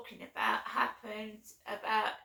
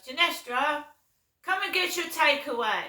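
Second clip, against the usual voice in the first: high, loud sing-song call; 4 semitones higher, 9 dB louder than usual. Retold or imitated. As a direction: summon her daughter across the flat. Mum calling her usually.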